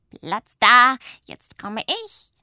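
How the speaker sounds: loud high whoop, then slow; bossy shout. Acted. Witch pushes through the children on the slope, bossy shout. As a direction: clear the slope.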